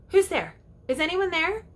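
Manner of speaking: high searching call into the dark; demo of Peter calling out. Shown as a demonstration. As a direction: call out as Peter, listening for an answer. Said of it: "I'll give you an example".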